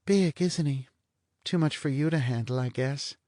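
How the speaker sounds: a bored blonde toying with him; lazy blonde. Acted. Blonde teases Vanier about his sex appeal, languid and high.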